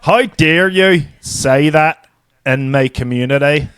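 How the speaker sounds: slow, exaggerated Belfast accent; drawn-out mock Belfast accent. Shown as a demonstration. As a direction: repeat it with broad Northern Irish vowels. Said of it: "do it one more time".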